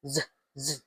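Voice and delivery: "z, z" as two low, soft buzzes; Z sound. Shown as a demonstration. Teacher showing the Z sound, Z sound. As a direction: buzz each "z" separately. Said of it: "Think as zebra".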